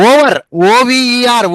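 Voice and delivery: Over then ఓవీఈఆర్ letter by letter, slow and loud; spelling it out. Shown as a demonstration. Teacher spelling the word over loudly for students. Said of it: "ఈ విధంగా".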